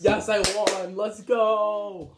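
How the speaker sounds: excited cheer. Real, not acted. Winning the challenge.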